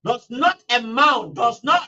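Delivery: slow, with "not" stressed hard in "Does not amount"; emphatic repeat. Real, not acted. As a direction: lean on "not" to drive it home. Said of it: "we said very emphatically".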